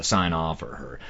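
normal talking voice

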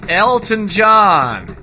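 loud, high, drawn-out name; mock-grand holler, long last word; hammy exclamation. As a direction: belt out the name, stretching it slowly. Hammy repeat after naming him.

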